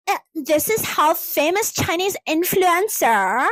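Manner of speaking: slow, drawn-out parody influencer; dumb influencer accent. Retold or imitated. Influencer presenting someone's glow-up, dumb-accent parody. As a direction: put on a brain-rot influencer voice. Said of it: "I was doing a fucking stupid influencer".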